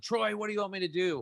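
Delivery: high-pitched yell retold without volume. Recalling crying out to his late brother, high retold yell. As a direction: call out high, imitating a desperate yell. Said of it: "I yelled out".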